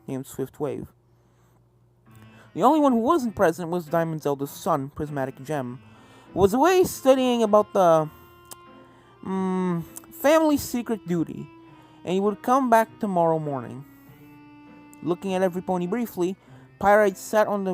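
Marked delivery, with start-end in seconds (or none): none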